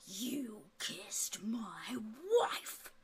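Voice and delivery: hushed, very slow whispered accusation; outraged king. Acted. King confronting Lord Florian over the kiss, hushed outrage. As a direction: draw it out softly, menacing as a wronged king. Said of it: "And the king goes".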